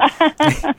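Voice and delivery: a sudden high laugh; high burst of laughter. Real, not acted. Host laughing at the guest's accent, a high burst of laughter. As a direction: laugh out loud at the joke.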